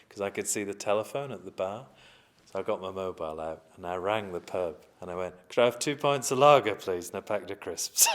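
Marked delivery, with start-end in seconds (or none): 5.5-8.1 calling out the order loudly, half laughing; loud mock order over the phone, laughing through it; loud cheeky order. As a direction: bellow it down the phone like a bar order. Retold ordering pints by phone.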